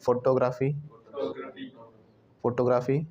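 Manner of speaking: फोटोग्राफी as even photo-graafi; old wrong way. Shown as a demonstration. Teacher contrasting the old habit, even pronunciation. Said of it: "बोल कर देखो पुराने वाला".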